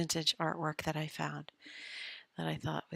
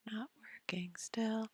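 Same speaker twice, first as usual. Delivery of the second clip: whisper; 4 semitones higher, 8 dB quieter than usual. Real, not acted. Whisper to self.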